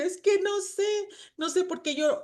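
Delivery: very high, soft, helpless voice; mock tearful reply. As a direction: imitate yourself answering through tears. Acts out her tearful past answer.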